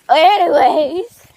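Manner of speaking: loud, slow sing-song; loud playful anyways; drawn-out anyways. Real, not acted. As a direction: stretch it out to move on.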